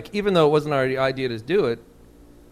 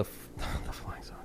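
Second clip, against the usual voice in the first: whispering; 15 dB quieter than usual. Real, not acted. Whispered self-correction while laughing.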